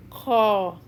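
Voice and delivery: Kha as a scraping K H in the throat; raspy Kha. Shown as a demonstration. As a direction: rasp the Kha from the back of the throat. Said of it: "sounds like K H in English".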